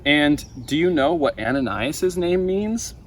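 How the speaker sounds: usual speaking voice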